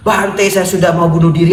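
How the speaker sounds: loud high plea to the monk; half-joking cry of despair; desperate outcry. Retold or imitated. Umat berseru putus asa. (seruan putus asa yang setengah bercanda)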